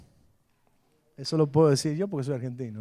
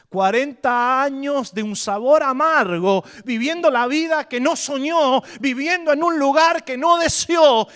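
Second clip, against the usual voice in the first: louder, higher preacher's cry, building; full-force preaching; 11 semitones higher, 13 dB louder than usual. Acted. Preacher to his congregation about Moses, at full force. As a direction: preach it to the back row.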